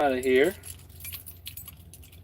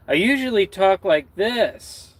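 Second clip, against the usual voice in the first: usual higher voice; 6 semitones higher than usual. Shown as a demonstration. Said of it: "putting more bass in my vo".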